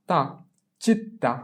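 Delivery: ordinary talk, nothing marked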